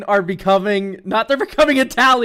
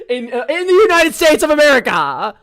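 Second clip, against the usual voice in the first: laughing through In the United States of America, a bit louder; 7 semitones higher, 6 dB louder than usual. Real, not acted. Tacking on a laughing punchline to viewers, laughing. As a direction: giggle out the punchline. Laughing aside after joke.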